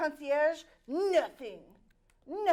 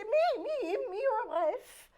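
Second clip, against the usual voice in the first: mock desperate plea; 10 semitones higher, 6 dB louder, much slower than usual.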